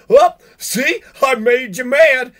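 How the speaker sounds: high, loud taunting singsong, slowing on the last words; mocking cop. Ranter mocks a cop gloating, high and loud. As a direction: gloat like a cop who baited someone into anger.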